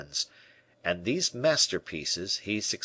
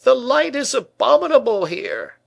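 very high, loud complaint; vexed cry; 10 dB louder than usual. A narrator's reading. Painter complains about the light, vexed and crying out. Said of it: "cried the vexed painter".

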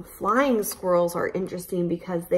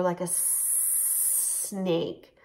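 very slow, softer snaky stretch; slow slithering; 8 dB quieter, much slower than usual. Shown as a demonstration. Teacher slithering like a snake, very slow and soft. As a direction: drag the words out like a snake. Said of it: "slither across the floor".

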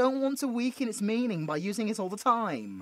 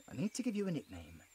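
much softer, fast aside to herself; muttered to self; 6 semitones lower, 11 dB quieter, faster than usual. Acted. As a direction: mutter it under your breath quickly. Repeating it to herself.

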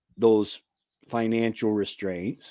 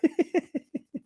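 amused laugh; 4 dB quieter than usual. Real, not acted. Laughs at own accent bit.

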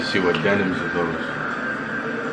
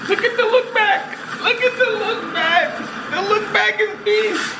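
excited hype. Real, not acted. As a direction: shout out the moment. Hyping the girl's look back.

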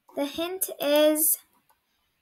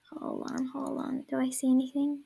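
hushed; 7 dB quieter than usual. Real, not acted. Hiding, hushed.

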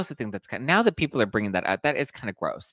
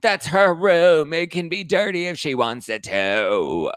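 high, loud, slow mock outrage; mocking angry fans; 7 semitones higher, 5 dB louder, slower than usual. Host imitating fans who got mad at her criticism. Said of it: "People got mad at me for saying".

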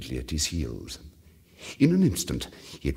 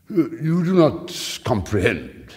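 slow, louder, in another voice; slow loud Fortunato; 6 semitones higher, 7 dB louder, slower than usual. A narrator's reading. Fortunato testing Montresor, slow and loud. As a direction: lean in, drawing it out. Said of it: "he said".

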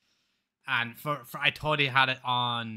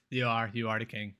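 ordinary talk

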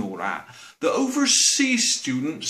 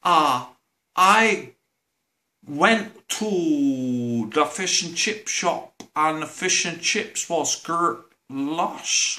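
imitating a Chinese student; much slower than usual. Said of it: "they says things like".

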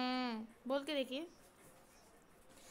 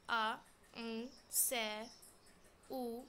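ordinary delivery

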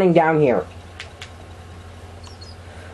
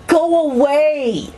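drawn-out yell; 9 semitones higher, slower than usual. Real, not acted. Yelling at witch.

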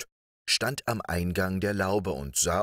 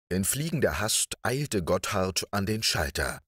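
ordinary delivery

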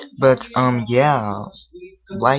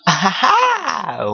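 odd laugh; 10 semitones higher, much slower than usual. Acted. Odd outburst, then "what was that.

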